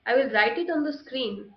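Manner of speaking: ordinary talk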